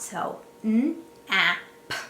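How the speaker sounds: "n, a, p" said as separate sounds; segmenting sounds. Shown as a demonstration. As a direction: tap out "n", "a", "p" one at a time. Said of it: "tap it out".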